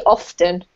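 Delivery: "often" with the T pronounced, off-ten; sounded T. Shown as a demonstration. Teacher allowing the other pronunciation, T sounded in "often". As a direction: say "often" with a clear T. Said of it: "for some weird reason, you can say".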